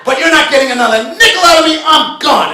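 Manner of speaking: high, loud furious rant; shouting at the clerk while storming out of the store; angry shouting. Retold or imitated. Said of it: "storming out of the store and screaming".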